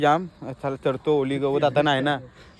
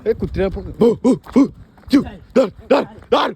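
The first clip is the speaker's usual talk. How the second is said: shouting; 6 semitones higher, 6 dB louder than usual. Retold or imitated. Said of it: "मला बोलते".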